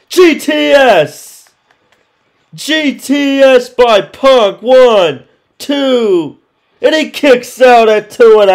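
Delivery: loud, slow, high ringside call; wrestling commentator. Acted. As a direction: call the big move like ringside. Live wrestling play-by-play.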